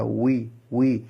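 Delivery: উই, উই drawn out slowly through rounded lips. Shown as a demonstration. Teacher slowly repeats উই for students to practise. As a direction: repeat উই slowly for the class to copy. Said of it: "ভালোভাবে practice করে নেবেন".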